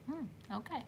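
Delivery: very soft, understated acceptance; quiet retold reaction. Retold stunned reaction, said under breath.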